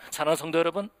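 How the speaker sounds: usual speaking voice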